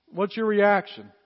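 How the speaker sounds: ordinary talk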